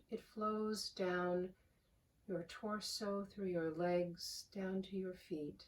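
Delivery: calm, unhurried voice with long pauses; soothing prayer-meditation delivery, phrase by phrase; guided meditation. Real, not acted. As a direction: guide them gently into the breath, softening as you go. Leads a guided breathing meditation.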